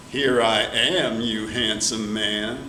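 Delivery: low, slow coy purr; flirty. Acted. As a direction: draw it out, flirting. Jolie flirts with Pierre.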